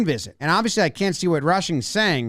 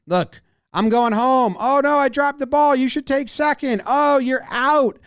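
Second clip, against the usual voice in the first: mock play-acting; 7 semitones higher than usual. Fielder pretends to drop the ball, hammed-up innocence. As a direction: ham up the fake blunder in a high voice. Play-acts a rope-a-dope trick.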